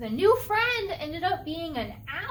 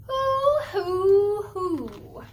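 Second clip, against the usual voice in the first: long, high owl hoots, falling away; 3 semitones higher, 5 dB louder, much slower than usual. A narrator's reading. Owl in a children's story calls to Bear, hooting. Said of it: "an owl says".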